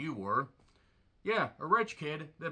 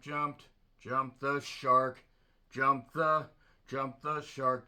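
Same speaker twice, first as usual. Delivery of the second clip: slow stammer; 3 dB louder, much slower than usual. Pretend. Stuttering repetition.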